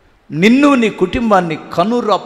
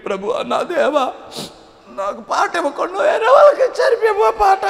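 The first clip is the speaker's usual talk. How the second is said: high, whiny mock prayer of a complaining minister; mock whining servant; 12 semitones higher than usual. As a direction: parody a servant moaning to God about his workload. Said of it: "మన పరిచారకులు అలా ఏడవరు". (ఫిర్యాదు చేసే పరిచారకుని ఎగతాళిగా అనుకరిస్తూ, ఎక్కువ స్వరంతో ఏడుపు ప్రార్థన)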